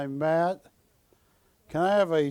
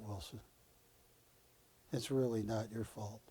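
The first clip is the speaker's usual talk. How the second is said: soft, hushed reassurance, fading toward a murmur; a therapist gently breaking down a patient's walls; tender movie consoling; 3 semitones lower, 5 dB quieter than usual. Retold or imitated. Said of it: "Matt Dillon cried in the movie".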